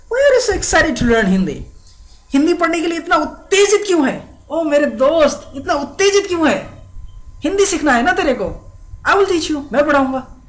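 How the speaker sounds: high-pitched, animated mock exchange; excited acting. Friend asking why so excited to learn Hindi, acted excitement. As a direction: play it high and hammed-up, brimming with eagerness. Acts a dialogue about being excited.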